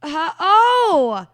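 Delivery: loud, high, mock panic; hammed-up shocked reaction; alarmed uh-oh. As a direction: gasp like you hit a landmine. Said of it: "they hear that and they go".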